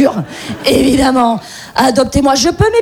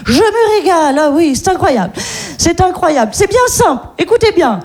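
put-on posh rich-lady accent; 6 semitones higher than usual. A rich woman boasts to the audience in a posh accent. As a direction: gush in a rich woman's accent about doing nothing. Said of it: "parfaite maîtrise de l'accent de riche".

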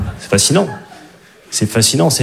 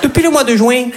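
put-on much higher, sing-song Midi accent; southern French accent; 10 semitones higher than usual. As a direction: play a romcom couple with a Marseille twang. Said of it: "avec l'accent du Midi".

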